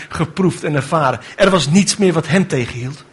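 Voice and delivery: ordinary talk, nothing marked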